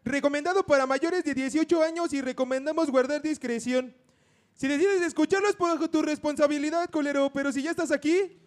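loud, high hype announcer read. Acted. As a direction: boom it out like a radio host. Reads a mock content warning.

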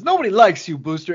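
mock taunt. Villain taunts Booster before pushing him.

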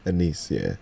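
low, unenthusiastic answer; deadpan, without a spark of excitement; unexcited. Real, not acted. Uncle confirming an incoming niece, thoroughly unexcited. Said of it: "don't sound so excited".

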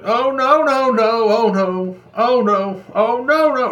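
high, panicked oh no, no, no; panicked wail. Retold or imitated. Finding no toilet paper, a high panicked oh no. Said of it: "It's like".

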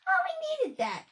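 high-pitched mock grateful voice; mocking quote. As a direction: mimic an over-happy person up high. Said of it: "and be like".

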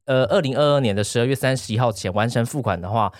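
ordinary delivery

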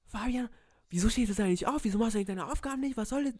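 high, loud mock teacher scolding; imitating yelling teacher. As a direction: ham up the shrill teacher telling him off. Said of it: "Die hat mich übelst angeschrien". (hoch und laut nachgeäffte schimpfende Lehrerin)

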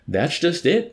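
bitter retort, high and loud. A narrator's reading. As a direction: throw it back bitterly. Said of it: "the major answered bitterly".

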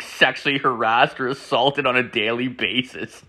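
normal talking voice

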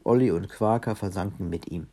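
plain reading voice

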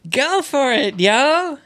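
high-pitched cheerleader chant. Pretend. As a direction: cheer them on, voice way up. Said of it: "チアガール的な動きをしてました". (チアガール風の高い応援声)

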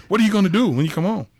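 high, loud, quick scolding mentor; stern teacher. Retold or imitated. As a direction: press him hard for an answer. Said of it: "Miss Pitts".

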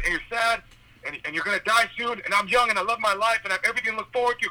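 high, fast mocking rant; retold yelling rant. Yelling at the old driver, high fast mocking rant. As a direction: rattle off the insults up high. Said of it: "I was, like, yelling".

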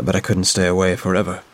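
ordinary delivery

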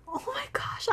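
high, airless whisper; overwhelmed whisper. Real, not acted. Fan recalling how much she cried, whispering. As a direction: whisper it as if too moved to speak.

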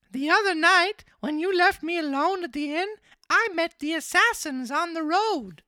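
very high, loud puppet voice; marionette voice. Acted. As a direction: pitch it way up as the naive little marionette. Voices Pinocchio in story.

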